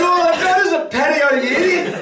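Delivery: very high, indignant comic yell; mock outrage. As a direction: yell back at him in exaggerated exasperation. Indignant retort to cheerful boy, retold.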